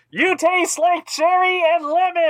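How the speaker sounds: high, slow, far-off voice; distant faint voice. Retold or imitated. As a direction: call it from far away, high. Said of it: "someone was in another state going".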